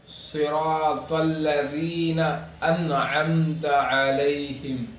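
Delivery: very slow صراط الذین انعمت علیہم; measured Quranic recitation of صراط الذین انعمت علیہم; heavy saad recitation. Shown as a demonstration. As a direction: round the ص in صراط and hold long vowels. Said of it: "پھر اسی طرح صاد ہے".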